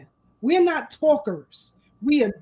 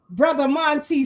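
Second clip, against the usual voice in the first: ordinary delivery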